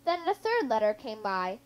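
usual speaking voice

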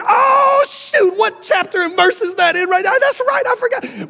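high-pitched retold outburst of sudden recall; hammed-up "oh shoot" moment; excited realization. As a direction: squeal it out, as if it just hit you. Retelling his own sudden excited realization.

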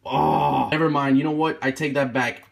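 ordinary delivery

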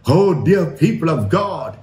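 ordinary talk, nothing marked

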